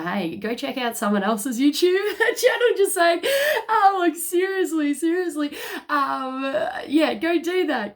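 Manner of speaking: high loud laughter with a crying edge; can't stop laughing, near tears; laughing till tearful. Real, not acted. Telling viewers to try other readers, laughing tearfully.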